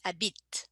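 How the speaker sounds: "habite" said "abit", silent E, T pronounced; final T sounded. Shown as a demonstration. Teacher showing learners how "habite" ends. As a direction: end "habite" crisply on the T. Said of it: "I don't pronounce the E, but I do pronounce the T".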